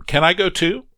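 high, bright-eyed eager ask; pleading to tag along; a child begging to come too; eager plea. Acted. Girl eagerly asks to come.

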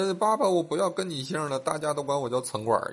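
high-pitched mock crying kid; hammed-up childish complaint; child whining tearfully. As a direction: whine to Dad like an upset little boy. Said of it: "跟他，跟他爹哭".

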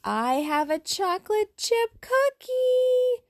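high, slow call across a room; yelling across the room. Acted. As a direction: call out loud to someone far away. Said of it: "Olivia yells across the room".

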